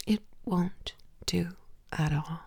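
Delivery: same words dropped to a whisper; whispered repeat. Acted. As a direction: whisper it back teasingly.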